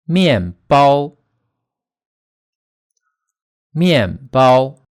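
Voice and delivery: 面包 with 面 falling sharply and 包 held high and level; fourth plus first tone. Shown as a demonstration. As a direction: drop 面, then bounce up to a long flat 包. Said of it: "ending first tone is high and flat".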